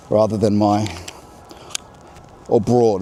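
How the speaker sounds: ordinary talk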